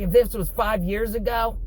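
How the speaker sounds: high-pitched mock threat; imitated angry threat. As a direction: act out an angry worker squaring up. Acting out angry worker's threat.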